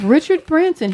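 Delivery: high loud name call; excited announcement. Acted.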